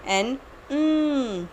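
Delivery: एन, उम hummed through the nose; nasal N. Shown as a demonstration. Teacher showing the N sound comes through the nose. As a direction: let उम come from the nose, slowly. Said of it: "इसकी sound हमें नाक से आएगी".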